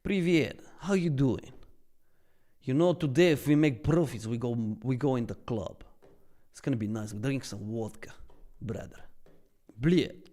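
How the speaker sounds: put-on Russian accent; friendly hammed-up Russian accent. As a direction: play a vodka-loving Russian pal with a thick accent. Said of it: "Let's do some Russian accent".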